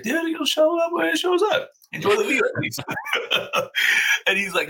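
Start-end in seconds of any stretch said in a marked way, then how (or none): 0.0-2.8 high reassuring voice. Retold or imitated. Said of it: "I was like". 2.8-4.2 high laugh. Real, not acted. Laughing at his frustrated buddy.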